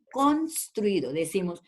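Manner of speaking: construido drawn out slowly, each syllable clear; slow careful pronunciation. Shown as a demonstration. Teacher modelling construido slowly for Sarita. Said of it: "decimos construido".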